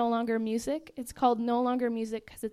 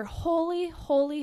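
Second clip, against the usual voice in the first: raised, drawn-up cry of praise; proclaiming angel; 6 semitones higher, 5 dB louder than usual. Retold or imitated. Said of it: "this huge angel comes out".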